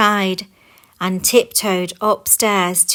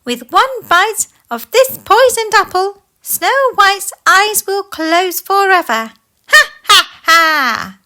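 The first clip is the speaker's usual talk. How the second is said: put-on much higher, louder old hag voice; witch cackle; 11 semitones higher, 8 dB louder than usual. Disguised queen gloating over the poisoned apple, witch cackle. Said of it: "she cackled".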